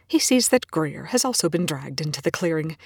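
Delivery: ordinary delivery, nothing marked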